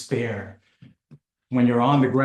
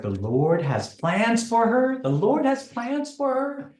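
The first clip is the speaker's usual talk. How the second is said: rising, climbing much higher in outrage; incredulous disbelief; 9 semitones higher, slower than usual. Retold or imitated. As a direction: repeat it back, stunned and disbelieving. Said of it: "I was like".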